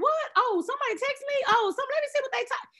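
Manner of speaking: high and fast, eager over a new text; distracted excitement. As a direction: snap to the phone like a kid who heard the doorbell. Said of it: "I be like".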